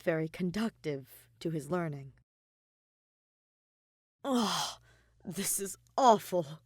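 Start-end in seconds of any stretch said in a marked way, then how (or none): 4.2-6.6 drawn-out, louder groan of a complaint; whiny complaint. Acted. Kaveh slumped over his desk after studying, whining. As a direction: moan it out slowly, fed up with studying. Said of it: "he complains".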